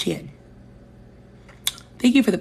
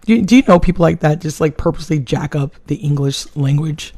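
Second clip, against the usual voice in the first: ordinary talk, nothing marked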